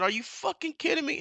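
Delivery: outraged fan yelling at the TV; retold outraged yell. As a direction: throw it out like you can't believe your eyes. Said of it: "I said".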